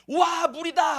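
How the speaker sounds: thrilled shout of relief, mildly acted; excited cry. Thirsty Israelites spotting water at the oasis, excited cry.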